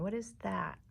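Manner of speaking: usual speaking voice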